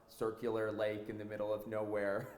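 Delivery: ordinary delivery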